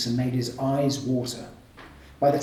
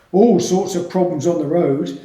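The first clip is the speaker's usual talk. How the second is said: hearty porter; 3 semitones higher, 8 dB louder than usual. A narrator's reading. Porter voiced in reading.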